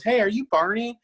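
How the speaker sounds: put-on high little kid asking. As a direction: pitch it way up like a curious child. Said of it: "And the kid goes".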